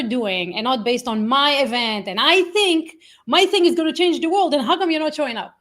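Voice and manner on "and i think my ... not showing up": higher-pitched mock self-importance; lightly hammed-up indignant pitch; self-absorbed seller. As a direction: act out an over-keen promoter, then puzzled. Acting out self-centred event seller.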